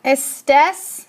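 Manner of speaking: "Estés" stressed on the final "tés"; stress on last syllable. Shown as a demonstration. Teacher modelling the tú form, "Estés" with final-syllable stress. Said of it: "not on the second to last".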